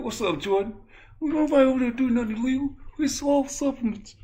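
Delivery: squeaky, high-pitched defensive protest; hammed-up high denial. Acts the boxer's defensive protest.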